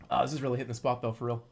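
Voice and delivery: talking while chewing; mouth full. Real, not acted. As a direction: speak through a mouthful of pizza. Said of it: "Don't talk with your mouth full".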